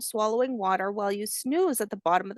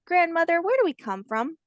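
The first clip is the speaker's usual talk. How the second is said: higher and louder, a wondering little voice; curious hatchling; 7 semitones higher, 3 dB louder than usual. Acted. Hatchling asks grandmother where they come from, high childlike voice. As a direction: ask like a wide-eyed child.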